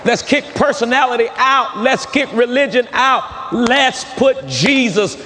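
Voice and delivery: high, drawn-out rallying cry from the pulpit; rallying preaching. Real, not acted. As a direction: urge the congregation on, pitched high, stretching the key words.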